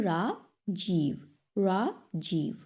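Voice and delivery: "Rajiv" split as रा, जीव; syllable by syllable. Shown as a demonstration. Teacher shows another split, "Rajiv" in two parts. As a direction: pause after रा in "Rajiv". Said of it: "break this word into two syllable".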